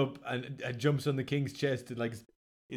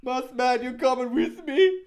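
character voice; 10 semitones higher, 3 dB louder than usual. Acted. Character tug of war.

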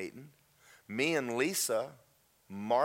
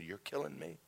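pained admission; 2 semitones higher, 9 dB quieter than usual. Retold or imitated. Repeats anguished words to God.

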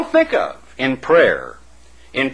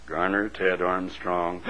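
low, soft, slow name call; hushed voice of God; 10 semitones lower, 10 dB quieter than usual. Acted. God in heaven pronouncing your name, low and hushed. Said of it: "with his booming voice, pronounced".

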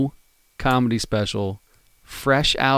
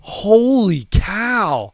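drawn-out, high-pitched disbelief; real, slow-rolling astonishment; astonished; 7 semitones higher, much slower than usual. Real, not acted. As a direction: stretch it out slowly in amazement at a shocking score. Astonished at co-host's low rating.

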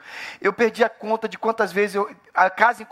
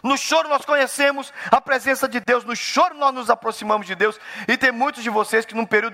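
raised, driving pulpit voice, higher; fervent preaching; 8 semitones higher than usual. Acted. Pastor preaching to the church at full force. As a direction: preach it out to the congregation, pushing each phrase.

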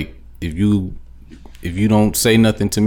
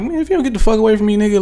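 fast, higher, heated comeback; heated retort; 10 semitones higher, faster than usual. Retold or imitated. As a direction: snap back at a stranger, fast and high. Angry retort to stranger.

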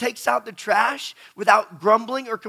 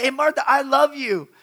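louder, higher, more insistent "I love you"; almost calling it out to her; husband pleading for an answer; pushy repeat; 6 semitones higher, 7 dB louder than usual. Retold or imitated. Third try, growing upset.

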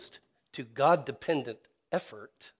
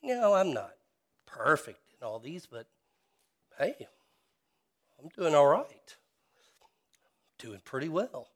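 smug self-satisfaction; 4 semitones higher, 5 dB louder than usual. Retold or imitated. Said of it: "self-righteous hypocrisy which says".

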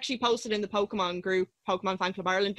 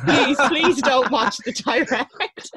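slow, louder, begging plea; pleading friend; 4 semitones higher, 5 dB louder, much slower than usual. Retold or imitated. Friend begging them not to watch the announcement, slow plea. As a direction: beg them slowly not to look.

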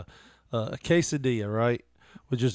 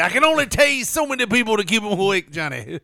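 higher, louder put-on voice; hammed-up backstage quote; mock quote voice; 8 semitones higher, 6 dB louder than usual. Friend retelling a backstage line, put-on voice.

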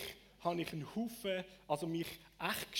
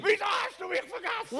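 high, full-force yelled question; anguished shout; 11 dB louder than usual. Acted. Interpreter mirroring the psalmist's complaint to God, shouting.

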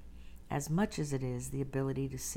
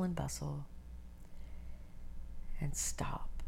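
guided meditation; 4 dB quieter than usual. Acted. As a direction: ease them into stillness, breathy and unhurried.

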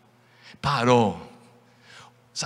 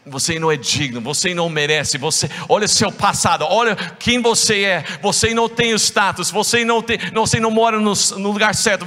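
rising, louder contemptuous taunt; scornful accusers; 8 semitones higher, 5 dB louder than usual. Acted. People telling you that you are unworthy, scornful. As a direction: pile on the scorn. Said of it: "pessoas vão falar pra você".

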